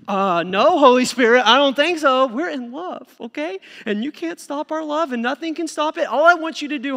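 put-on high, loud whiny teen protest; defiant teenager. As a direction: play the stubborn lovestruck kid talking back. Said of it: "a young person who's dating".

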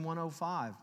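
ordinary talk

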